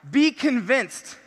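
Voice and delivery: very slow, weighty; slow emphatic. Real, not acted. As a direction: drag out be convinced. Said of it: "You guys wanna know a key?".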